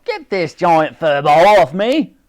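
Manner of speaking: loud, high, slow strained cry. A narrator's reading. The Admiral is pinned under Wooley, crying out. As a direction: cry for help from under a weight. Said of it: "cried the Admiral".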